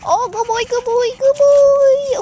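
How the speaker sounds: high, loud, slow sing-song praise; excited dog praise. Real, not acted. Owner praising her dog on a training walk, high excited praise.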